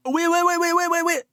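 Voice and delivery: very high, rapid-fire monotone squeal; silly squeaky repetition. Pretend. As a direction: chirp it like a little alarm. Silly reading of a chat name.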